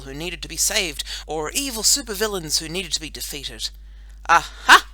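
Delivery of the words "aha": loud, sudden cry of discovery; triumphant aha. A narrator's reading. Boy superhero spots an evil supervillain, triumphant. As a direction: pounce on the discovery.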